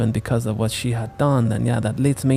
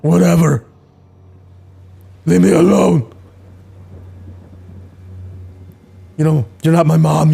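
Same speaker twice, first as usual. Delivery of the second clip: bratty yelling; 4 semitones higher, 5 dB louder than usual. Acted. Kid yelling at Grace.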